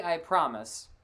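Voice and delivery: ordinary talk, nothing marked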